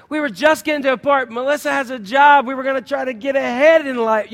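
hammed-up woe-is-me complaint; self-pitying whine. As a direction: whine about your bad luck. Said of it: "climbing into my hole with the woes".

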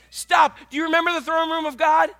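ordinary delivery

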